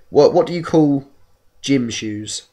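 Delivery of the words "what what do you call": ordinary talk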